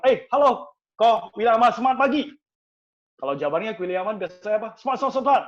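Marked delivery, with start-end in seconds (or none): 0.0-2.2 high, loud, upbeat hello; cheerful greeting. Acted. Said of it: "tipe penggerak itu biasanya suaranya ceria". 4.7-5.4 high, loud chant of enthusiasm; cheerful rallying reply. Acted. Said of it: "ceria, gerakannya lincah".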